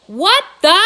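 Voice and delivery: loud, high startled outburst; angry demand. A narrator's reading. Angry boss answering an unexpected phone call, loud outburst. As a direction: bark it out in shock and fury. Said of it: "he demands".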